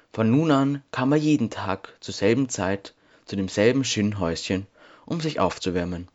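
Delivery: ordinary delivery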